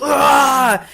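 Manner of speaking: very loud high shriek; Chucky attack scream. Retold or imitated. Chucky lunges at Karen, loud high attack scream. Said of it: "he goes".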